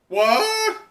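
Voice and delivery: very high, loud startled yelp; shocked cry. Acted. Bystanders shocked by the collapse, high yelp. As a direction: yelp in disbelief. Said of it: "they go".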